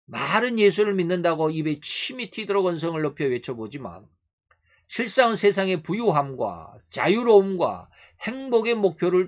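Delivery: loud, indignant pulpit oratory; forceful preaching. Real, not acted. Preacher exposing loud professions of faith, forceful preaching. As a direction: build long emphatic phrases like a rebuke.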